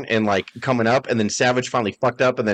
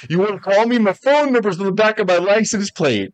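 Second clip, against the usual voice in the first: high, louder wrestler impression; Macho Man; 9 semitones higher, 3 dB louder than usual. Quoting the great line from his interview, high wrestler impression. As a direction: imitate a cocky wrestler's promo voice, pitched way up. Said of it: "Macho Man uttered the great line".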